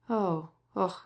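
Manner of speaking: a long heavy sigh, half groan; deep sigh. A narrator's reading. The prince sighs heavily after leaving the poor couple. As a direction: sigh it out, weighed down by pity. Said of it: "he said with a deep sigh".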